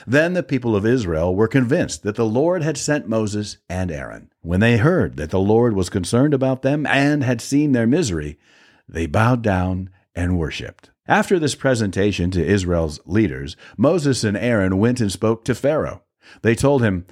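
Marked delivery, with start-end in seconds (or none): none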